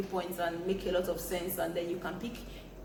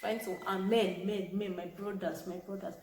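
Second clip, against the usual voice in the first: ordinary talk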